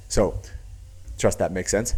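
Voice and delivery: ordinary talk, nothing marked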